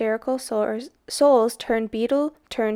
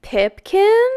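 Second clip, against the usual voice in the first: much higher, louder; astonished question; 9 semitones higher, 8 dB louder than usual. A narrator's reading. The boys recognize Pipkin, astonished. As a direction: gasp his name in disbelief. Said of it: "said everyone".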